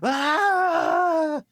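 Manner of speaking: high, drawn-out mock wail; slow, loud melodramatic lament; captain's anguished cry. Captain kneeling for his fallen parrot, anguished wail. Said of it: "takes a knee and goes".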